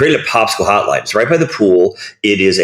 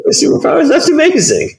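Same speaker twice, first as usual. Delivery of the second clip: giddy high-pitched delight; faster than usual. Real, not acted. Telling friends about a wacky hero, high giddy delight. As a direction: voice jumps way up, laughing with glee.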